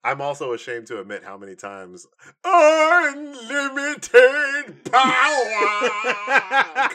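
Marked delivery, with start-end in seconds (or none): 2.4-6.9 higher, louder, slow stretched unlimited power; mock Sith lord, laughing through it; hammed-up Palpatine. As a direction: drag it out like a cackling emperor.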